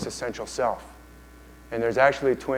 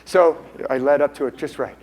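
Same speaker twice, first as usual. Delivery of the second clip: ordinary delivery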